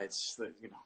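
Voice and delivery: usual speaking voice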